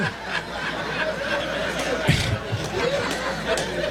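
high airy burst of laughter. Real, not acted. Congregation laughs at joke.

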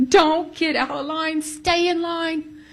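high, slow, tearful pleading; tearful plea. Retold or imitated. Crying as she begs her friend to stay in line. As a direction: beg her through tears to stay in line. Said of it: "And I started crying".

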